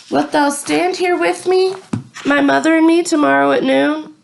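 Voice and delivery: lightly voiced child asking; child Pearl pleading. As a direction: beg him gently like a small child.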